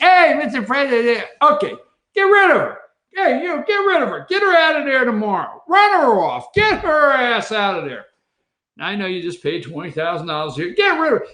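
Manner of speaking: hammed-up boss ordering a firing; mock Trump order. Trump ordering ambassador fired.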